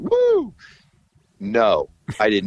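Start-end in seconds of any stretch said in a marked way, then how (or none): 0.0-0.5 very high-pitched celebratory "woo"; hammed-up whoop of delight; imitated whoop. Musicians hearing about big tour money, imitated whoop.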